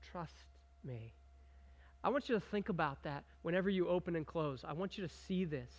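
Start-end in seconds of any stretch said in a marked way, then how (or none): 0.1-1.1 very soft, low, hushed; soft voice of God. Retold or imitated. God gently urging his people to trust, hushed. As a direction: let it fall to a hush.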